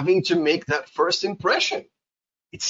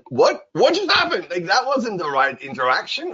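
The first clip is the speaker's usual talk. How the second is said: mock confusion; 6 semitones higher than usual. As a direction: sound completely thrown. Teacher voices confused reaction.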